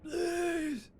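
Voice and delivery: a high, pleading cry; high strained please; desperate plea. Acted. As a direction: beg him with the last of his strength. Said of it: "he looks over at you and says".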